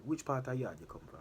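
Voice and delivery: put-on porter's question in a light Jamaican accent. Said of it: "I still didn't put on my Jamaican accent".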